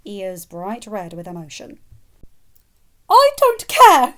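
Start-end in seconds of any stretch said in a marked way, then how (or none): 3.1-4.1 very high, loud and slow; shrill, furious refusal. Acted. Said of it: "she declared shrilly".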